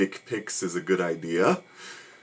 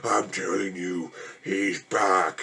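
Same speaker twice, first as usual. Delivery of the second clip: slow, portentous doom-sayer's line; lightly voiced grim doctor, warning of the killer's return; a hint of a solemn horror-movie prophet; Loomis-style warning; much slower than usual. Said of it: "the Doctor Loomis pastiche".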